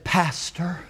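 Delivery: unvoiced, hushed "Pastor"; whispered Pastor. Church member leans in to confide in the pastor, whispering. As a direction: breathe "Pastor" in a conspiratorial whisper. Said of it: "he said".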